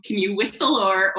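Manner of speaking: ordinary talk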